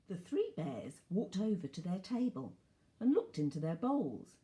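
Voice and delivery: usual speaking voice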